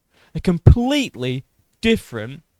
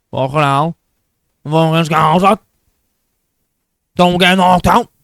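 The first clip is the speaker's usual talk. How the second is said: louder mock Liverpool yell, rising; mock Scouse shout; 2 semitones higher, 9 dB louder than usual. [name] shouting at the end of his interview, loud mock Scouse. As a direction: bark it out like a hyped Scouser.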